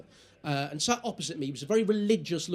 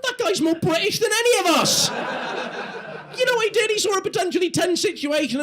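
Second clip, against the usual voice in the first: much higher, loud laughing praise; delighted shout; 13 semitones higher, 6 dB louder than usual. Real, not acted. Comedian cheers the man's joke, higher and laughing. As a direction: crow with delight at him.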